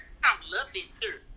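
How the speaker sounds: sinks to a soft, knowing hush; dropped low for emphasis. Real, not acted. Lets the words fall away soft and sure. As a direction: tells the host she can promise it, voice dropping soft. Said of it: "When I say he gonna love it here".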